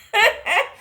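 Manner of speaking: high loud laugh; burst of laughter. Real, not acted. Narrator to viewers, bursting into laughter. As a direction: laugh at yourself getting caught.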